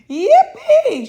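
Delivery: very high loud whoop with a laugh; an old man's shrieking yippee with a laugh; joyful shout. A narrator's reading. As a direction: whoop for pure joy. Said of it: "shouted Grandpa Joe".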